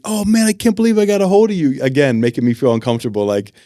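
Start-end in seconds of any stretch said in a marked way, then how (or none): 0.0-1.8 high, excited; thrilled fan on the phone; imitating an excited fan. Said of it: "And he was like".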